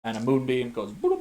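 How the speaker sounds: usual speaking voice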